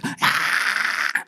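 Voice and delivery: imitated child's piercing scream; sudden mock screech; high-pitched scream. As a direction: mimic a kid screaming for food. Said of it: "high-pitched screaming".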